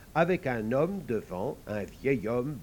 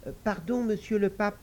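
little devil; 8 semitones higher than usual. Acted.

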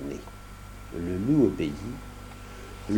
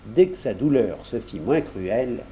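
usual speaking voice

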